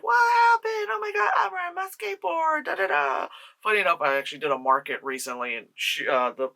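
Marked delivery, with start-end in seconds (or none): 0.0-3.3 put-on much higher, breathless little girl. Reviewer mocking the little girl from the old movie, much higher. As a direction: squeak it out like a startled kid. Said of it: "Little girl is like".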